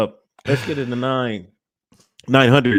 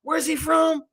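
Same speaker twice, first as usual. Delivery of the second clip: higher, softer put-on voice of a surprised fan; imitating amazed fans; 9 semitones higher, 6 dB quieter than usual. Fans wonder where the British rapper is from, higher mock surprise. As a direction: play the puzzled fan asking where he's from. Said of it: "They be like".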